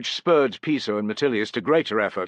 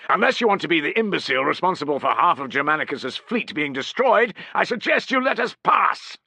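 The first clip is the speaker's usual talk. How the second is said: angry tirade; 8 semitones higher, 4 dB louder than usual. A narrator's reading. Piso berates sentry.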